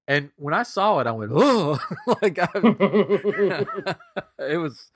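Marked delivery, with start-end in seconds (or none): 1.3-1.8 sudden loud high gasp of fright; loud startled oh. Retold or imitated. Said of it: "a loud, "Oh!". 2.1-4.0 real laughter breaking through the words; laughing. Real, not acted. Laughing at his own fright.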